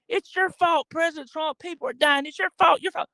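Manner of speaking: high, whiny mock accusation; mock accusers. Pundit parodies crowds blaming President Trump, high whine. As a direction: ham up the shrill blaming crowd.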